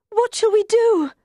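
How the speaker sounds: high, loud, panicked queen; frantic, high-pitched wail; desperate cry. Acted. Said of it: "she cried".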